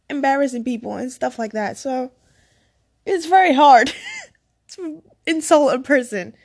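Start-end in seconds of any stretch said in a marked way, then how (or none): 3.1-6.3 much louder, laughing through the words; loud embarrassed laugh mid-sentence; laughing loudly. Real, not acted. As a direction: burst out laughing at yourself. Laughing at own weak insult.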